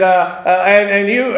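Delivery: ordinary talk, nothing marked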